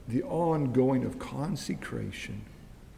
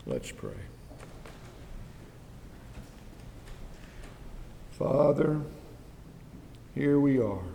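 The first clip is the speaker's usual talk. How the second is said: soft, earnest prayer voice; closing prayer; 2 semitones lower, 4 dB quieter than usual. Acted. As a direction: pray humbly for the congregation.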